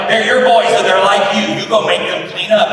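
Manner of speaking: mock exasperated wife raising voice; exasperated declaration. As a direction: pin it on him, loud and fed up. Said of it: "She's like".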